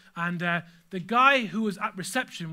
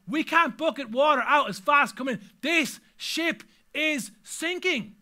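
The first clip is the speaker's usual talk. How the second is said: raised, louder voice of alarmed disciples; higher, urgent alarm; dawning panic; 6 semitones higher, 6 dB louder, slower than usual. Acted. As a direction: act out sailors realising the boat is going under. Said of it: "All of a sudden it dawns on them".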